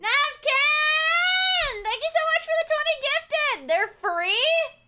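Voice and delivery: high, loud shrieked thanks; excited shouting. Real, not acted. Streamer thanking chat for twenty gifted, excited shouting. Thanking gift subscriber at the top.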